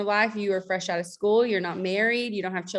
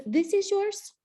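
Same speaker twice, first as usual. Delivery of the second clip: much higher, light voiced host; host's question; 12 semitones higher, slower than usual. The host showing her the house, voiced much higher. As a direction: voice the host, bright and high.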